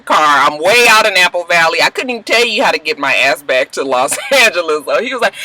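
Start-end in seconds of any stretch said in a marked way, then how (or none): none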